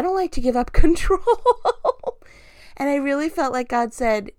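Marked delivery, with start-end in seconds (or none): 0.0-1.2 laughing it out, higher and louder; a real laugh breaking into the words. Real, not acted. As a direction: giggle through the admission. Laughing at her own confession.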